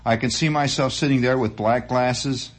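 ordinary talk

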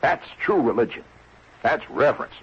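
normal talking voice

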